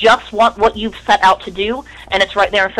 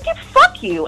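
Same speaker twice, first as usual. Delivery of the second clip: far higher, mock angry retort; retold angry retort; slower than usual. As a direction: snap it off like the brother leaving. Brother tells Lavelle off.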